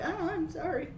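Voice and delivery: high, soft, trembling apology; timid quaver, nearly crying; shaky voice. Retold or imitated. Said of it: "That shaky voice".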